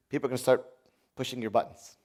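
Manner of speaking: ordinary delivery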